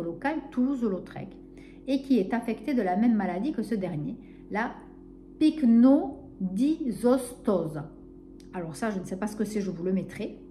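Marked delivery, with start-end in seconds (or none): 5.4-7.8 pycnodysostose said very slowly, syllable by syllable; careful reading. Real, not acted. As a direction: sound out pycnodysostose carefully, one syllable at a time. Reads out a hard medical term. (pycnodysostose articulé très lentement, syllabe par syllabe)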